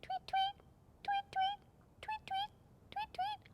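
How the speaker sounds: very high, soft little chirps; quick squeaky tweets in pairs; bird tweeting. Shown as a demonstration. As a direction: imitate a tiny bird. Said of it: "Hear its funny sound".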